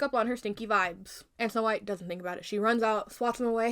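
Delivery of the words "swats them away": ordinary delivery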